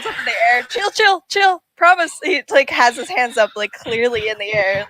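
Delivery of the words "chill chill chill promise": high, quick calming words; nervous, high-pitched reassurance; placating plea. Acted. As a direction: plead harmlessness to someone aiming at you. Bard pleads with armed guards.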